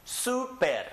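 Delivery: Super as SOO-pehr, high first syllable; written-accent stress. Shown as a demonstration. Teacher models a word with written accent, Super stressed high on SU. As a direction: hit SU in Super, falling after. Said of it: "Has a written accent".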